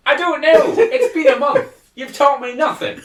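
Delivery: high, whiny outburst; hammed-up squealed indignation; mock protest. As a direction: complain like an aggrieved pupil. Acted complaint to vampire mentor.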